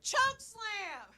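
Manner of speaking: high-pitched yelled catchphrase, far from her own voice; mock battle cry. To her date across the table, a mock wrestler's yell. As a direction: bark it out like a wrestler calling a move.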